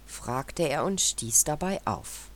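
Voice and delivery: usual speaking voice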